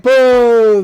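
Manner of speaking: loud, high mock boo; crowd booing. Recapper voicing a disappointed audience, loud mock boo. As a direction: boo them off the stage. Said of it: "everybody is like".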